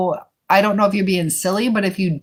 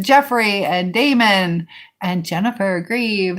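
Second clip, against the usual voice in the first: ordinary talk, nothing marked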